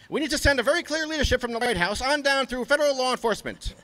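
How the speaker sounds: high mocking lawman proclaiming prohibition; Harry Anslinger voice. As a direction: pitch the voice way up and lay down the law like a mock bureaucrat. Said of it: "Harry Anslinger has more to say".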